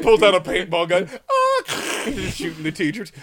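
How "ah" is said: very high, loud battle shriek; mock scream while opening fire; hammed-up high yell; attack scream. Imitating kid with paintball gun.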